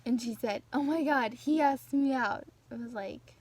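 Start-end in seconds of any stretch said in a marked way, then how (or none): 0.7-2.4 louder, higher gushing voice; excited gush. Retold or imitated. Imitating the friend bragging on the bus, excited. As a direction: squeal out the news like the friend.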